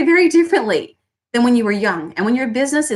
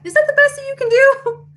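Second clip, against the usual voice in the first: much higher, cartoonish haggling customer voice; mocking haggler. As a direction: squeak it like a cheeky bargain hunter. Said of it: "Tell me yes if you heard that".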